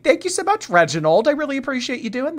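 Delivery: gushing high-pitched thank you; excited thanks. Real, not acted. Streamer thanking Reginald for gifts, high gushing thanks. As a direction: squeal your thanks to the donor.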